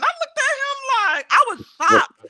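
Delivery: high, loud shocked voice. Retold or imitated. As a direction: sound stunned by it. Retelling shock at the flirt.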